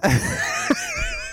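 a big high burst of laughter; shrieking laugh mid-story. Real, not acted. Laughs recalling the fight.

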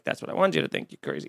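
whispered sly aside. Retold or imitated. Teller gloats to his co-host about the clerk, whispered. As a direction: drop to a conspiratorial whisper.

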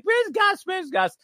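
high, loud mock calling out; calling for Gus. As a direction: call out for the missing boy, pitched high. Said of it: "everyone's like".

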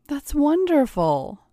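high, drawn-out delight; pleased exclamation, pitch lifted high. Acted. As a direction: gush at the good news, slowly. Fiancée delighted at job news.

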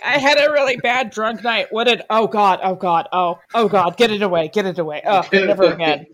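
loud panicked mock horror; phobic panic. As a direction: panic at a bad memory. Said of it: "She's like".

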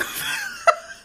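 high shrieking laugh; real laugh spilling over; high cackle. Real, not acted. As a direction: laugh from the top of the voice. Laughs after mock wail.

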